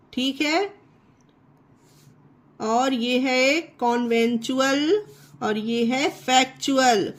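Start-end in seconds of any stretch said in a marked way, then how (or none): none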